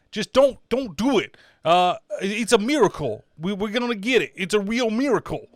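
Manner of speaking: hammed-up Boston mob-movie accent; mobster-style Boston accent. Reviewer mocking the actor's priest, in a mobster-like Boston accent. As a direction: swagger through it in a bad Boston accent. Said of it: "looking at mob, like, movies".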